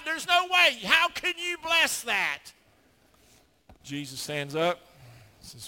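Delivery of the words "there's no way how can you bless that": gloating, jeering accuser with cackles, high and loud; mocking Satan. Preacher voicing Satan accusing David before God, gleeful jeering. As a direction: taunt like a sneering devil.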